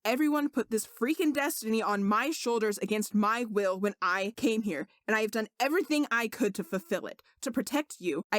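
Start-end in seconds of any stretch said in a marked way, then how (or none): none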